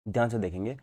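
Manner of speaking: ordinary talk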